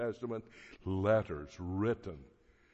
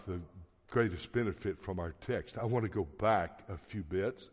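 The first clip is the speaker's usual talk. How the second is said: ordinary talk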